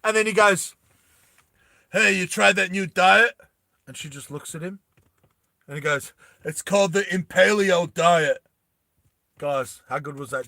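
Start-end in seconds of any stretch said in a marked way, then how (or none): 1.9-3.4 louder, higher character line; acted taunting setup. Character sets up taunting joke. 6.4-8.4 higher, louder one-liner; acted punchline. Character delivers punchline before killing.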